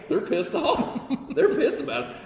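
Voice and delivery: laughing. Real, not acted. Laughing while telling.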